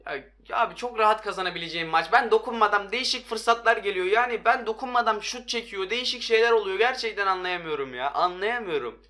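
worked-up gamer venting; real frustration. Real, not acted. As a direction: complain in heated disbelief. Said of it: "çok sinirlendim yani".

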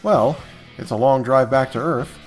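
ordinary talk, nothing marked

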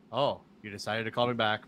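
low, deadpan monotone; monotone Tommy Dreamer. Retold or imitated. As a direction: answer the phone in a low drone. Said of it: "He goes".

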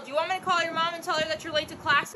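ordinary talk

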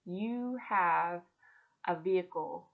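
gently reassuring, like a patient parent; God lovingly answering a complaining mom. Retold or imitated. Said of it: "so lovingly came to me and said".

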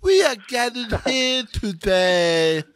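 high, very slow, pompous lisp; hammed-up Princess Bride wedding officiant; lisping movie clergyman. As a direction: draw out each word with a thick speech impediment. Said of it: "sound like the guy in, uh, uh, Princess Bride".

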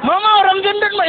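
put-on loud, high child's yell; a child yelling to his mother about a stranger; child shouting. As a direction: shout it out like a bratty kid pointing. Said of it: "dia berteriak".

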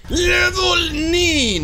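long, high level shout; drawn-out excited game announcer yell; hyped announcement. Real, not acted.

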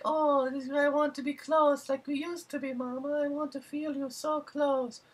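very high falsetto pleading; mock creepy son. Retelling the son's creepy plea to his mother, high falsetto. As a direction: croon it high like a creepy mama's boy. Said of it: "And he's like".

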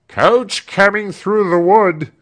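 loud high call from a servant; crying out. Acted. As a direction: call the coach arriving across the yard. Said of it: "cried Britain".

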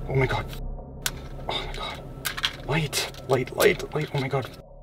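scared breathless whisper; nervous night vlog whisper. Real, not acted. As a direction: whisper in rising panic. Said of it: "I just heard a whisper".

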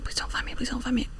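frightened whisper; whispering while hiding. Real, not acted. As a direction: plead in a hushed whisper.

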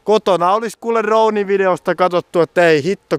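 annoyed retort about the drone video; exasperated. Real, not acted. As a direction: throw it back with exasperation.

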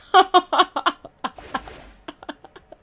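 high, loud giggling burst; real giggle fit. Real, not acted. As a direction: let the laugh spill out again. Keeps laughing at herself. (risa aguda y fuerte)

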